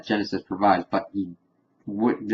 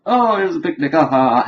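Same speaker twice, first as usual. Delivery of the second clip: carefree spectators; 6 semitones higher, 3 dB louder, faster than usual. Retold or imitated. Voicing carefree Civil War spectators.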